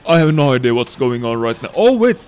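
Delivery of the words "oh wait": sudden realization. Real, not acted. Spots something in game.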